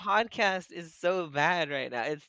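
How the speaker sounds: exasperated complaint. Real, not acted.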